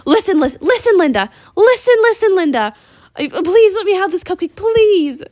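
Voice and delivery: put-on high, loud pleading kid voice, fast repeats; begging little kid. Little boy begging his mom Linda for a cupcake, high whiny plea. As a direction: beg like a small boy desperate for a cupcake. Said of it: "he was like".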